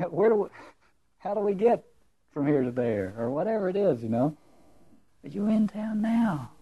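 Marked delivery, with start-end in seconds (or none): 5.2-6.5 hushed whisper, full of awe. Shown as a demonstration. Preacher whispering the woman's line in awe. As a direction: breathe it as a secret.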